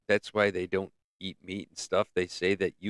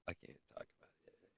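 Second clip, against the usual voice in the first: quick hushed whisper, fast; secretive whisper; 6 semitones lower, 28 dB quieter, faster than usual. Acted. Host whispers to viewers he can't say more. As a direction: whisper it like a secret you shouldn't share. Secretive aside, cuts himself off.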